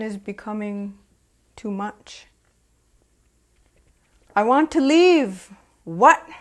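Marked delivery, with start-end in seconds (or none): 4.3-5.5 raised plea. Acted. Quoted plea in abuse poem. 5.8-6.3 a sharp, startled shout; loud outburst. Acted. Partner snapping back at the speaker, a loud sharp shout.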